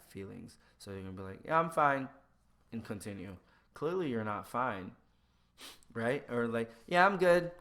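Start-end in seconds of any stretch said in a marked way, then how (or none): 1.4-2.1 loud, high brush-off; false cheer. Acted. Person hiding their feelings from others, loud false cheer. 6.9-7.5 very loud, high automatic reply; false cheer. Acted. Person clearly not good answers, loud false cheer.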